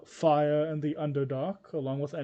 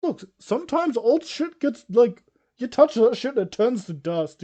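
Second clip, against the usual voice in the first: emphatic; 7 semitones higher, 7 dB louder than usual. Real, not acted. Heated argument for lamination.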